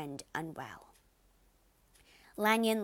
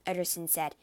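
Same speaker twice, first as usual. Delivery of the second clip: usual speaking voice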